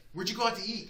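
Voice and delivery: wife asking in mild shock; surprised wife. Retold or imitated. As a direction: play the wife's startled disbelief. Wife's question retold.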